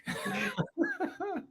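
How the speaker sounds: very high-pitched, soft laughing. Real, not acted. Teasing friend as Captain Hook, high laugh.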